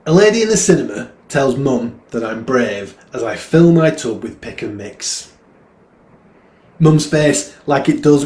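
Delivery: put-on loud, animated boy's voice, lifted in pitch; puzzled young boy. A boy tells of the lady in the cinema, loud animated recital. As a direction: recite it as a boy wondering aloud, loudly.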